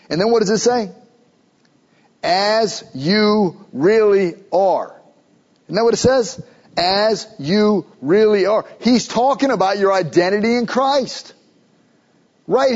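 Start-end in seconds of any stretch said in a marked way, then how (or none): 2.2-4.9 very slow, word by word; emphatic verse. Real, not acted. 6.8-8.7 slow, each word set apart; emphatic repetition. Real, not acted. Repeats verse for emphasis.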